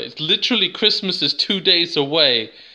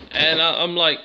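normal talking voice

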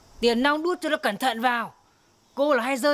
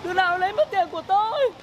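wailing for help; 7 semitones higher than usual. Acted. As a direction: cry out to the neighbours over the lost money. Lost money, wailing to the village. (than khóc kêu làng nước)

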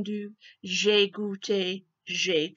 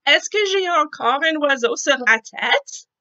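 anxious elephant; 5 semitones higher, 8 dB louder than usual. Acted. Gérald asks anxiously.

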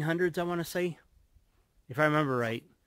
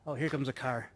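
ordinary talk

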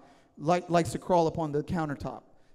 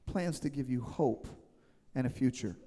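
softer and lower, almost to themselves; soft, lower repeat; 4 semitones lower, 6 dB quieter than usual. Shown as a demonstration. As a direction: let it fall quiet. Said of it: "repeated, softer".

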